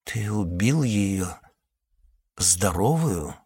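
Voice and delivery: slow, stiff-lipped and quiet; numb lips. Acted. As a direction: lips too numb to move. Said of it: "непослушными губами уточнил Кай".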